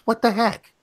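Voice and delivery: put-on high-pitched little-kid protest; retold child's voice. Granddaughter dodging grandpa's question, mock-child voice. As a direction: play the small child covering up.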